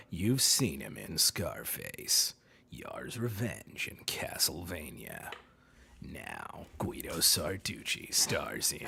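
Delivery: very deep, hushed, slow announcer; quiet, rumbling trailer voice; low Steve Blum step-off. Shown as a demonstration. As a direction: sink low and soft, drawing out each title. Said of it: "if you go a little low".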